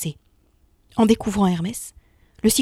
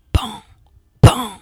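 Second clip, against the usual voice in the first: two hammer strikes, the second much louder; imitated hammer blows; 7 dB louder than usual. A narrator's reading. Narrator mimics the giant's hammer, banging out pan pan. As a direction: knock out the beats of a forge hammer.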